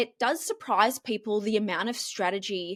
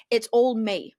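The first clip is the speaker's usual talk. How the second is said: ordinary delivery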